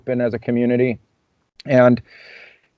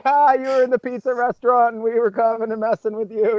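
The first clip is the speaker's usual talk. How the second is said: high-pitched imitation of playful pranksters; teasing friends; 9 semitones higher than usual. Friends greeting him back home, playfully teasing in high voices. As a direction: pitch it well up, mimicking their teasing.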